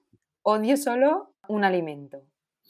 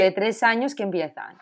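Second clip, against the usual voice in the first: ordinary talk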